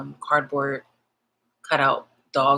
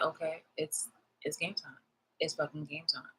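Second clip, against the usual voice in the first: hushed game time; 12 dB quieter than usual. Retold or imitated. Quoting herself before confrontation.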